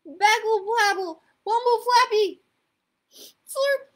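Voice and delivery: put-on loud, high toddler gibberish; toddler babble. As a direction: babble urgently at the top of your voice. Trixie keeps babbling at daddy.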